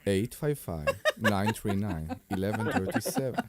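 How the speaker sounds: low seductive phone-number read; sexy voice. Acted. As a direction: purr the number low and smooth. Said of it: "[name]'s got the sexy voice going on".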